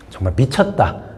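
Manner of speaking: ordinary talk, nothing marked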